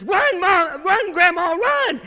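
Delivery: put-on a touch of a child shouting run; excited child. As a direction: cheer her on like an eager little girl. Child urging grandmother in story.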